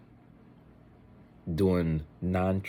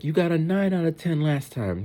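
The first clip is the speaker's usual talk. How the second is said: higher-pitched, lightly imitated mom; mock a mom challenging her kid, pitched up; scolding mom; 10 semitones higher, 4 dB louder than usual. Mom reminding boy of last time's mark, mildly imitated scolding.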